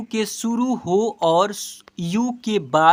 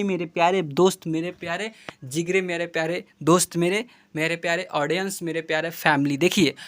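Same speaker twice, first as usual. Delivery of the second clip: normal talking voice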